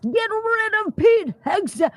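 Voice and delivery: high mocking imitation. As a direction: mimic the critics. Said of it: "Swamp Thing narrative".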